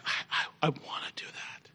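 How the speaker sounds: soft unvoiced whisper of awe; whispered wish. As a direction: whisper it like an awed boy. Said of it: "I said".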